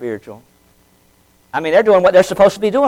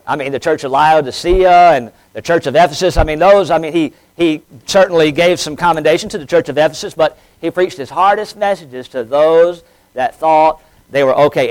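ordinary talk

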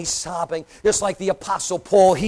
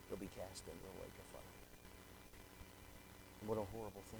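very soft and low, lingering; grave hush; 12 semitones lower, 25 dB quieter than usual. Acted. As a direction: mourn the blotted name quietly. Hushed grave warning.

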